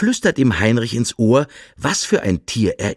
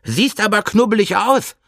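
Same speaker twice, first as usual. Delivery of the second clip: much higher, playful hare voice; teasing hare; 11 semitones higher than usual. Acted. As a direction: tease him in a high little hare voice. Hare teases the bear.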